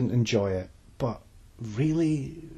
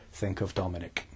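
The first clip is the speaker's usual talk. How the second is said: low hushed creepy murmur; 3 semitones lower, 8 dB quieter than usual. Shown as a demonstration. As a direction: say it like a creepy stalker. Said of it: "not in that creepy voice that I just did".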